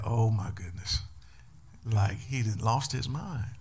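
low, soft whisper of disbelief. Retold or imitated. Disciples whisper to each other about Jesus, stunned. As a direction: mutter it in shocked secrecy. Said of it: "the disciples are looking at Jesus like".

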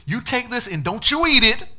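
high, loud mock warning; playful warning. Jesus warns the disciples not to eat the bread, loud and teasing. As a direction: scold them teasingly, loud.

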